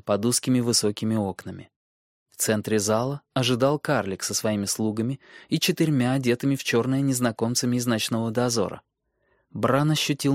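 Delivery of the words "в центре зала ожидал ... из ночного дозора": ordinary delivery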